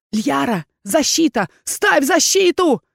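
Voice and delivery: high, loud, alarmed command; urgent shout. A narrator's reading. Erkhan ordering Liyara to raise the shield, shouting. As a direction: cry out an order, rising in urgency. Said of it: "закричал Эрхан".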